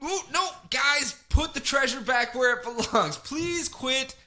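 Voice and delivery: high, scolding parent of toddlers; high exasperated parent; frazzled parent. Retold or imitated. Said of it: "Where it's like".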